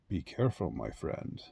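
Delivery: low, hushed menacing warning, fading to a whisper; a movie villain's soft threat; sinister villain. Retold or imitated. Concern troll warning a fellow poster, hushed villain. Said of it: "some movie villain uttering those lines".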